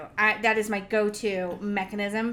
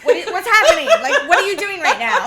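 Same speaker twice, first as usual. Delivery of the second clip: loud, high-pitched demands to know what's going on; comic freak-out on the edge of laughter; half-laughing, raised-voice bewilderment; panicked protest; 7 semitones higher, 10 dB louder than usual. Acted. Blindfolded freak-out, acted.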